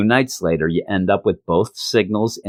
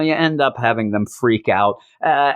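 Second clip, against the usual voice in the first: usual speaking voice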